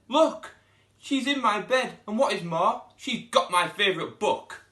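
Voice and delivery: high, loud little bear voice, upset; Baby Bear. Acted. Baby Bear finding Goldilocks in his bed, high upset voice.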